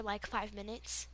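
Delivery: usual speaking voice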